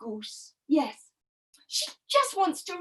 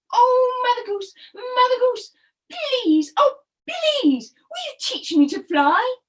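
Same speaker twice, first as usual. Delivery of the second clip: high, louder begging; pleading turtle; 8 semitones higher, 5 dB louder than usual. Acted. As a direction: implore her, pitch up. Turtle begs Mother Goose.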